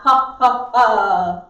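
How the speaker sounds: loud staged ha's, last one held; projected belly laugh. Shown as a demonstration. Drama teacher demonstrates projection, loud belly ha's. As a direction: send each ha across the room. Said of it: "same place as the belly laugh".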